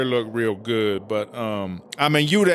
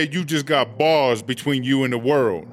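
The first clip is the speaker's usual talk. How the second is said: ordinary delivery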